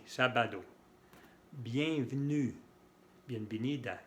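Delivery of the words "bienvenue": "Bienvenue" slowly as "byan-vnu"; silent middle E. Shown as a demonstration. Teacher modelling silent E, slow "Bienvenue" as "byan-vnu". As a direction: drop the E between V and N in "Bienvenue". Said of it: "una E que no se pronuncia".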